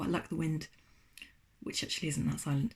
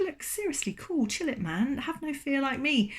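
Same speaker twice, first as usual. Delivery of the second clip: much higher, chill marshmallow voice; breezy marshmallow; 7 semitones higher than usual. Acted. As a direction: tell the bear to relax. Marshmallow calms the bear.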